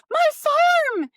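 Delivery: put-on high, loud, delighted little boy shout; excited child cry. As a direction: cry out like a five-year-old spotting his toy. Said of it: "and he goes".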